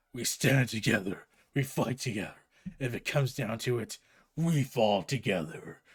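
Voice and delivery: very low, solemn superhero voice; hammed-up deep Batman, dead serious; grim, brooding Batman. Plays Batman in the comic recap.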